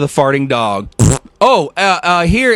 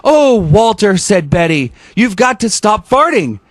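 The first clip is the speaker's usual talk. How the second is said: lively read-aloud narration; storybook narration; story reading; 3 semitones higher than usual. A narrator's reading. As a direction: read the picture book to the kids. Reads picture book aloud.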